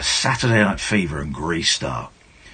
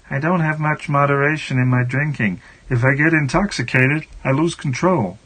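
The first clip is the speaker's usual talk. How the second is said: higher, put-on voice for a confessional quote; 5 semitones higher than usual. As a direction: read the confession in character. Voices [name]'s quote.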